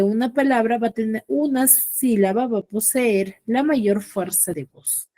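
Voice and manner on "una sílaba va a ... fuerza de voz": ordinary talk, nothing marked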